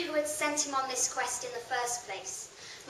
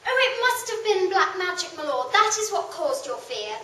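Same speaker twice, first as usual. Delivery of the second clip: loud, urgent loyal knight; loud insistent young knight; eager knight; 6 semitones higher, 7 dB louder than usual. Acted. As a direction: press your lord to let you go. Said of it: "Gawain insisted".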